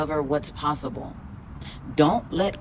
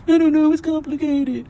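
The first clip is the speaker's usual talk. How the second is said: whiny excuse voice; 9 semitones higher, 6 dB louder than usual. Pretend. Voicing an imagined viewer's excuse.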